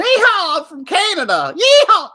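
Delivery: loud, very high Southern cowboy whoop; hammed-up Southern yee-haw; exaggerated Southern drawl. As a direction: holler like a mock Southern cowboy. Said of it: "I just had a sudden drawl there".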